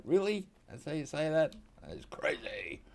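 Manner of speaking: high, loud Really, then whispered That's crazy; mock disbelief. Australian mocks his own reaction to Kiwi speech, high mock disbelief. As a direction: play an amused, incredulous listener. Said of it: "it makes me laugh sometimes".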